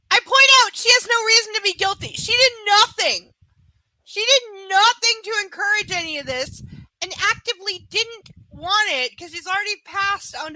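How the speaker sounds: high, loud, fast outraged shouting; furious rant. Real, not acted. Reader rages about the hero blaming the heroine, a loud furious shout. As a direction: yell it out, defending her.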